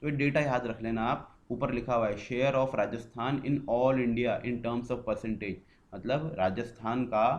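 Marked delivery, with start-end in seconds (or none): 2.2-5.6 very slow, word by word; slow dictation. Shown as a demonstration. Teacher dictating the table heading, very slow. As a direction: read the heading out so students can copy. Reads heading slowly aloud.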